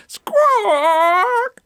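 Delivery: one long, piercing squawk; very high, loud bird cry; parrot squawk. Acted. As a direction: screech like a noisy parrot. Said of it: "Pedro's squawks".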